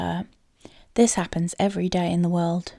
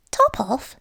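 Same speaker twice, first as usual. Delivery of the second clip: puzzled mouse; 6 semitones higher, 5 dB louder than usual. A narrator's reading. Mouse repeats odd name.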